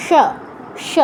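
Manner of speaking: স as "sha" with tongue curled back; retroflex sha. Shown as a demonstration. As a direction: curl the tongue back and hush স. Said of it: "মূর্ধন্য স্বর উচ্চারণটা কেমন".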